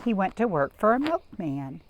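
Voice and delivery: plain reading voice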